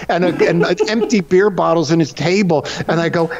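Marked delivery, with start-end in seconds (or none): none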